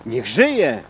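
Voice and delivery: brief swooping cheer inside a recitation; cried-out toast. Acted. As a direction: call out the toast, understated. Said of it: "krzyknął sędzia".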